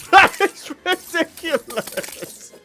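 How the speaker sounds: words through real laughter; delighted laughing talk. Real, not acted. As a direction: giggle at the absurd win. Laughing at outcome.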